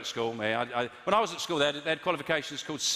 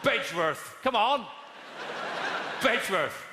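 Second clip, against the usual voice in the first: shouted heckle; 5 semitones higher than usual. Retold or imitated. Heckler shouting village name.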